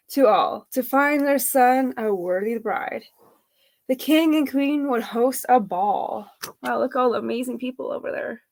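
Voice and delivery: ordinary delivery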